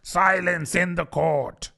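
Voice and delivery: the rabbit's mild mock cry for silence. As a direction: call the court to order.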